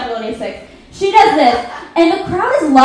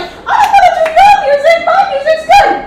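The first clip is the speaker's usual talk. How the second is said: much higher squeaky puppet, laughing through it; mock Prince's puppet in a squeaky high voice; silly puppet voice; 12 semitones higher, 4 dB louder than usual. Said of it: "in a fucking puppet voice that's like".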